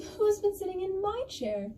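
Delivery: high-pitched, indignant bear voice; medium bear. Acted. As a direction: lift the voice high for the put-out middle bear. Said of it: "And the medium bear goes".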